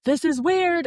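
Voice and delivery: high-pitched mock panic; retold panic. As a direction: repeat it in a high, strained retelling of panic. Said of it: "I start panicking and I just keep going".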